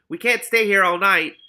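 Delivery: high, loud chorus of small voices; chorus of rats. A narrator's reading. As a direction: squeak it out as a crowd of rats. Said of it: "a chorus of voices".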